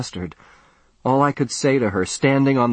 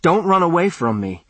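high desperate plea; begging; 8 semitones higher than usual. A narrator's reading. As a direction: cry out, begging her to stay. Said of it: "I begged".

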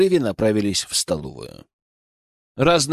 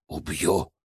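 lower, softer hissed threat; 8 semitones lower, 8 dB quieter than usual. A narrator's reading. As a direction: hiss the threat. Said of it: "прошипел им вслед Акира".

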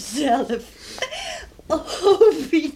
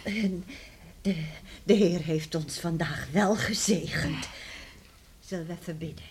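hushed; 8 semitones lower, 9 dB quieter than usual. Acted. Mother turns to prayer softly.

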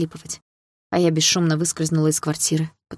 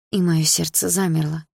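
ordinary delivery, nothing marked

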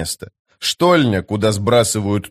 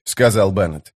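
ordinary delivery, nothing marked

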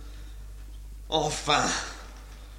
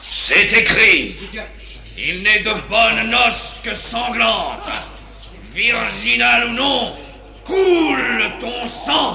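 menacing fury; 5 semitones higher, 4 dB louder than usual. Acted. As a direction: build to a murderous cry. Bouffon menace avec le poignard. (menace lente et meurtrière)

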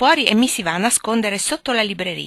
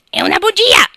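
high, loud whispery parrot screech; shrieking parrot; 10 dB louder than usual. Acted. Parrot shrieking at Lori, high screech. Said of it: "strillò in quel momento il pappagallo".

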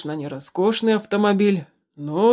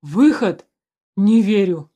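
breathless disbelief; 6 dB louder, slower than usual. A narrator's reading. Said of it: "выдохнул".

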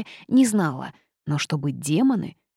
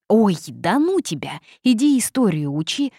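loud, higher, waving off the question; dismissive brush-off; 4 semitones higher, 6 dB louder than usual. A narrator's reading. First-year boy dismisses the witch's question, loud brush-off. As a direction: brush them off with raised voice. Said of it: "отмахнулся первокурсник".

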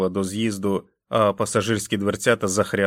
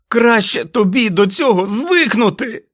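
much higher and louder, slow raging yell; furious shouting; 13 semitones higher, 8 dB louder than usual. Acted. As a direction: scream at him with each blow. Jack beating and yelling at wolf. (несамовитий лютий крик)

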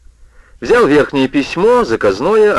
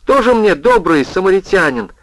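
sarcastic indignation; 8 semitones higher, 3 dB louder than usual. A narrator's reading. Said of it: "возмутился Орсон".

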